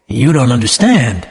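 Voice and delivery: ordinary delivery, nothing marked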